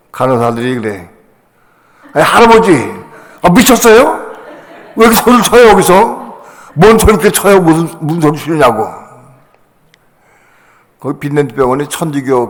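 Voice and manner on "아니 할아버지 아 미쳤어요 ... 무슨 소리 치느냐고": high, loud indignant telling-off; raised, agitated voice, demanding an explanation; scolding nurse. Retold or imitated. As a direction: imitate a nurse scolding an old patient. Nurses scold him for shouting.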